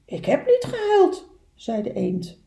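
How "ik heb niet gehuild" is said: high-pitched denial; duck character voice. Acted. Duck answering little beaver's question, high voice. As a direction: deny it in a high animal voice.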